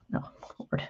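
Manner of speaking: under-the-breath whispered no, Lord; a real little groan of dismay, breathed; whispered to self after a slip; muttered dismay. Real, not acted. Mishap while sanding, under breath.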